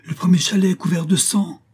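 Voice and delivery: high frightened shout; panicked shout of alarm; terrified cry. A narrator's reading. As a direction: cry out in terror.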